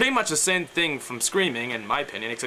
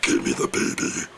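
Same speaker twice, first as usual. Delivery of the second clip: gurgly growl. Shown as a demonstration. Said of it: "more gurgly sounding".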